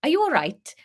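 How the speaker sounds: Harry asking lightly in Welsh; a hint of Welsh, airy; vague Welsh accent. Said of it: "I can vaguely do Welsh".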